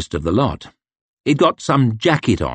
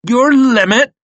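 growled; 6 dB louder than usual. Acted. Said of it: "growled the farmer".

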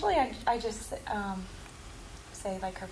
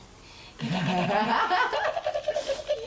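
warm laugh; 5 semitones higher, 4 dB louder than usual. Real, not acted. Laughing at brother imitation.

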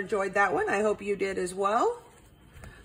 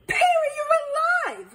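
very high, loud squeal of amazement; astonished cry; 5 dB louder than usual. A narrator's reading. Detective seeing Perry alive, crying out astonished. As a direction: squeal it in amazement, pitched right up.

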